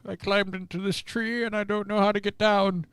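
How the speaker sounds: high, soft, sleepy bear voice; impression of a drowsy storybook bear; Winnie the Pooh. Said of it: "Pooh Bear".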